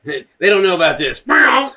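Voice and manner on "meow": high, loud saber swish; imitated lightsaber. Guessing how Darth Maul was cut, imitated lightsaber noise. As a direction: mouth a quick saber swing.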